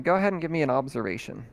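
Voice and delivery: ordinary talk, nothing marked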